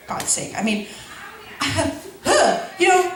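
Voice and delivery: ordinary talk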